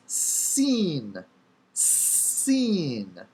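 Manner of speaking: SEEN with long s and drawn-out ee, falling; letter name demo. Shown as a demonstration. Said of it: "It makes the S sound".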